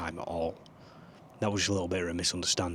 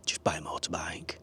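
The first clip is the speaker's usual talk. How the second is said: whispered advice; 3 semitones lower, 5 dB quieter than usual. Retold or imitated. My mate at work always says.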